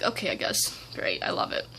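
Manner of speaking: whispered, dry and sarcastic; mock-cheerful whisper of defeat. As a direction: breathe it out like a fake thank-you. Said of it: "And it was like".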